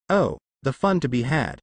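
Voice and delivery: ordinary delivery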